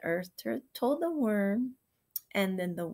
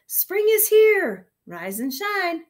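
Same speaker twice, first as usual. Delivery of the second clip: high, loud and drawn out; sing-song call; 10 semitones higher, 10 dB louder, slower than usual. A narrator's reading. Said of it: "sang to its neighbor".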